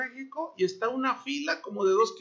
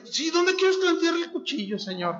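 Abraham; 8 semitones higher than usual. Retold or imitated. Abraham voiced obeying God.

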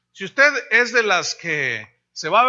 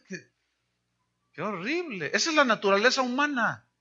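ordinary delivery

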